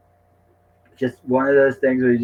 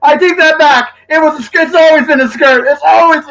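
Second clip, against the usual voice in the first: much higher and louder frantic take-back; hammed-up panicked retraction; 13 semitones higher, 7 dB louder than usual. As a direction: backpedal in a theatrical panic.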